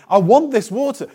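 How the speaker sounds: high, eager plea; acting out an excited woman begging; excited request. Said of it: "she says excitedly".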